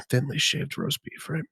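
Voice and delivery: ASMR voice. Pretend. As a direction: savour every word like a seductive ASMR whisperer. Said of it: "he said the first three words and he moaned".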